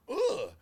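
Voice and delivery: high-pitched "ugh" of mock disdain; mock grunt. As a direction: grunt it up high, imitating scorn. Mocks how kids get labelled.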